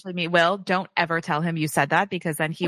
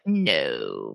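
a sudden much lower, flat no; stern Scorpio. Acted. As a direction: drop way down into a cold Scorpio refusal. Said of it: "the Scorpio's like".

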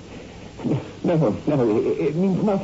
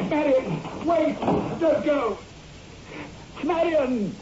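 higher, desperate calling; calling after her; 11 semitones higher, slower than usual. Acted. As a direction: call after her as she runs. Husband calls after fleeing wife.

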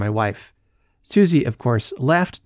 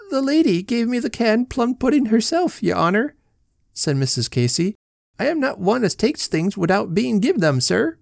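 put-on much higher plaintive old woman; Mrs Casey's voice; 10 semitones higher than usual. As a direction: whine it up high like a tearful washerwoman.

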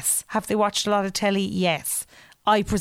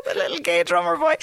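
laughing; 7 semitones higher than usual. Real, not acted. Joke said straight after big laugh.

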